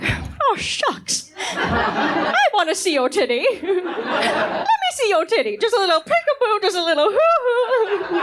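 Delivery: mock a pervy heckler begging; hammed-up creep, laughing; leering audience guy. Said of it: "some of you are like".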